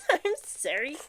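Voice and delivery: laughing apology, out of breath, slowed; breathless laugh. Real, not acted. Out of breath from rebuilding the fire, laughing apology. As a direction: gasp it out through a laugh. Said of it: "I'm out of breath".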